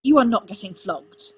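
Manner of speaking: loud, firm refusal; forceful, angry forbidding; snapped. A narrator's reading. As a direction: snap it as a flat order. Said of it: "Arthur snapped".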